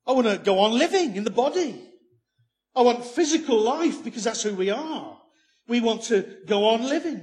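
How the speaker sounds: forceful sermon climax; preaching at full force. Real, not acted. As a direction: proclaim it to the congregation, loud and high.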